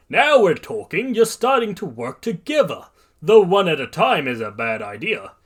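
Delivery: loud, high hearty approval; exclaiming. A narrator's reading. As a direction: boom it out, thrilled at their teamwork.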